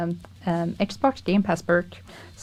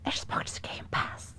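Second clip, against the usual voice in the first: hushed secret whisper; 14 dB quieter, much slower than usual. Real, not acted. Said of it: "Why are you whispering?".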